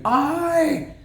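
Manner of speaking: voice cracking. Retold or imitated. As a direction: let the voice crack up high. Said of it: "His voice cracks".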